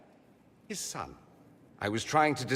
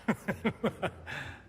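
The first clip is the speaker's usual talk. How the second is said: short amused chuckle; 6 semitones higher, 4 dB quieter than usual. Acted. Amused after grim joke.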